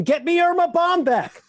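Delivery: high, loud hammed-up cop shout; cop's desperate cry. Stumped cops calling for the detective, in a high hammed-up shout. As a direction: yell it like a stumped police chief. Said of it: "they'd repeat that same immortal refrain".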